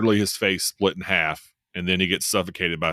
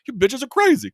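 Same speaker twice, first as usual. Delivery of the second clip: much higher and louder mock outrage; imitating Big Al; 5 dB louder than usual. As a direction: squeal it out as the shocked big guy. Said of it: "what does he say".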